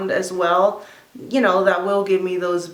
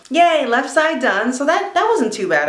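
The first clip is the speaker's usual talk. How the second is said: excited; 6 semitones higher, 5 dB louder than usual. Real, not acted. Celebrating finished side.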